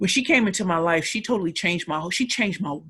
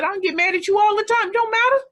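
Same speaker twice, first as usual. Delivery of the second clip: put-on high, loud grandmother setting a child straight; raised-voice motherly telling-off; imitated grandmother; 14 semitones higher, 7 dB louder than usual. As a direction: act out an insistent grandmother, pitched way up. Grandmother's scolding, acted out.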